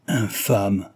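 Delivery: a touch of indignation; indignant interjection. A narrator's reading. Young woman interrupts her fiancé's killer, indignant. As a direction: cut in with restrained indignation. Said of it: "interrompit mademoiselle de Glandève avec indignation".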